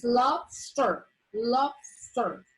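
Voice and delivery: "lobster" split into lob and ster; teacher dividing "lobster" with a gap mid-word; two syllables. Shown as a demonstration. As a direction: sound out "lobster" as two separate beats. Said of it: "which is two syllables because".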